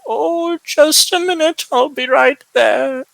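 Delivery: put-on high, creaky old woman calling out. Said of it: "sounds like an old woman".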